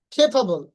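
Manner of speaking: "খেফাবল" with puffs on kh and ph; emphatic first syllable in "খেফাবল"; correct pronunciation. Shown as a demonstration. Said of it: "উচ্চারণ হবে". ("খেফাবল" খ আর ফ ধ্বনিতে)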